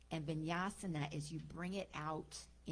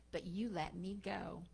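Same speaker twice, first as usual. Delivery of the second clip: plain reading voice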